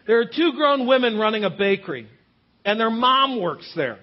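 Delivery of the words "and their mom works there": ordinary talk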